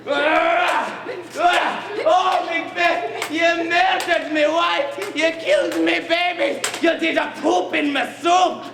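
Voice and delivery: mock-furious Scottish shout; shouting Scottish accent. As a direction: rage at Macbeth in broad Scots. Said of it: "in perfect Scottish accent".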